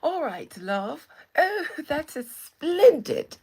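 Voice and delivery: loud, high hammed-up British delight; over-the-top British accent, stretched and loud; mock British accent. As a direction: gush like a caricature Brit. Playful mock-British reply, then laughs.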